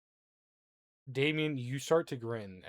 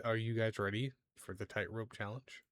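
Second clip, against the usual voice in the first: ordinary talk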